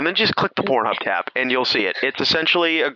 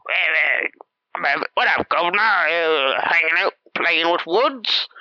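a hammed-up Cockney voice, higher; mock Cockney; 5 semitones higher than usual. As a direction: put on a jokey Cockney accent. Acting a movie character with Cockney accent.

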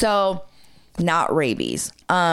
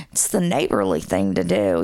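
put-on Southern accent; 11 semitones lower than usual. Storyteller quoting helpful Alabama neighbor, light Southern accent. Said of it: "he has this thick Southern accent".

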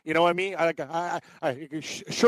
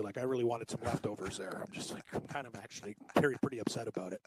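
softer, hushed complaint; lowered voice; 7 semitones lower, 13 dB quieter than usual. Real, not acted. Quietly griping about the eaten pie. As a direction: drop the voice, she might hear. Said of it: "I like how Cam lowers his voice".

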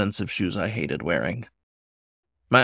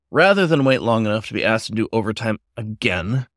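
plain reading voice

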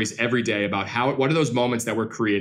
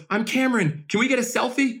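put-on high, eager little boy voice; imitating a kid; 10 semitones higher than usual. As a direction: play the excited young fan asking politely. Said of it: "He goes".

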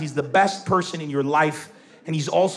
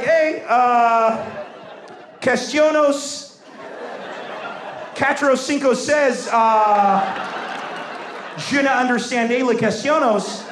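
high, drawn-out bluffed French; halting fake French; 4 semitones higher, slower than usual. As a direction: grope for each foreign word, pitched up. Pupil fumbles fake French to teacher.

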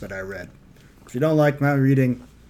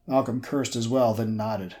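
usual speaking voice

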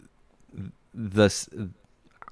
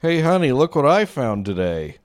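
higher, louder, proud husband voice; cheerful husband voice; 8 semitones higher, 5 dB louder than usual. Acted. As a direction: show off a find to your wife. Voicing a guy bringing trash home.

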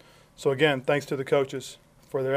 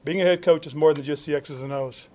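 normal talking voice

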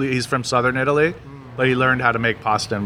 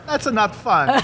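mom's voice, pitched higher; a lightly voiced Italian mom scolding; mom impression; 8 semitones higher, much slower than usual. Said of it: "She's like".

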